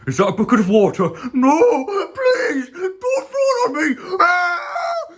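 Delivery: very high, crying plea rising to a scream; panicked car. Acted. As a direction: beg not to be soaked. Car begs not to be splashed.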